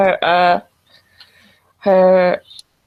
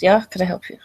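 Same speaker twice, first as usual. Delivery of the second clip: put-on curt Russian accent answering the phone; Russian woman's accent, brisk and guarded; faster than usual. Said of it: "she has a Russian accent".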